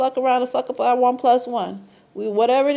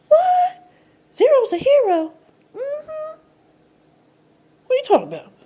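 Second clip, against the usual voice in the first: mock disbelief; 14 semitones higher, 5 dB louder than usual. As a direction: squeal it up high and loud. Astonished question answered in voice.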